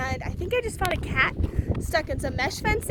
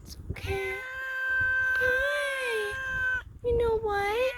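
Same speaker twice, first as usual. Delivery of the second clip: cooing to a kitten; 7 semitones higher, slower than usual. Real, not acted. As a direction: gently coax a frightened cat out, voice raised high. Comforting the sad cat.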